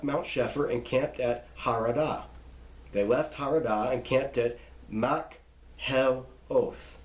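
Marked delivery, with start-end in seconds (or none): 4.9-7.0 "Makheloth" broken into mak, hel, oth; slow syllables with pauses on "Makheloth"; read slowly. Shown as a demonstration. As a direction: sound out each piece of "Makheloth" separately. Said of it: "You just read it slowly".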